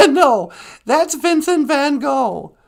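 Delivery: very high, loud giggly puppet retort; squeaky puppet, amused; high laughing puppet voice. Acted. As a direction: laugh then correct him as the tiny sidekick. Puppet sweetie corrects host.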